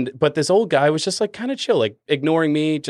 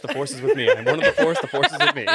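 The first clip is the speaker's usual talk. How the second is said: mock chosen one; 3 semitones higher, faster than usual. Bartender mocking a waiting customer, high laughing proclamation. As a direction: pitch climbing into a high, laughing mock-grand chant.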